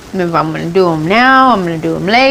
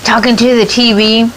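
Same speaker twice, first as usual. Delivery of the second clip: husband teasing; 6 semitones higher than usual. Retold or imitated. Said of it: "he's like".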